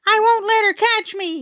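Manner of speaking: put-on scared little boy shrieking about the robot; panicked shriek. A narrator's reading. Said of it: "he shrieked".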